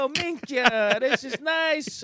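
high, loud hammed-up couple voice; mock sweet talk. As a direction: tease with a slow, exaggerated sweet-talk voice. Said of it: "You're not like".

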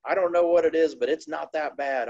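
playful ribbing from a grinning rival; imitating Dale. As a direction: tease him like Dale laughing it off. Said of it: "He goes".

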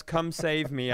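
ordinary talk

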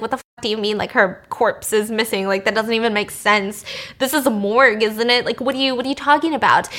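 ordinary talk, nothing marked

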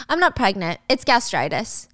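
matter-of-fact, slowing on the last word; smirking reveal. Acted. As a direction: drop the news with a slight smirk. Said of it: "Gia smirks".

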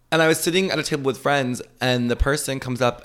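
usual speaking voice

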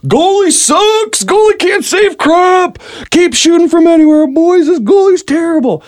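very high, slow drawn-out heckling; mock yelled taunt from a defender. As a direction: heckle the goalie across the field. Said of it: "all I hear is".

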